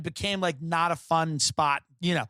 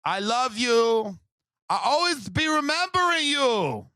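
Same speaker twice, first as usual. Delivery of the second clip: shouting lovesick post in a put-on accent; mock Spanish accent; 6 semitones higher, slower than usual. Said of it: "one girl over and over again".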